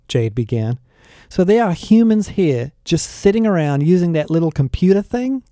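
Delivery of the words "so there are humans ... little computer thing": puzzled question. A narrator's reading.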